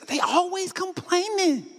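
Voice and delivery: high, slow, whining parent's gripe; lightly mocking nag; mock whiny complaint. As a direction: drag it out like a fed-up parent. Parents mocking their complaining kids.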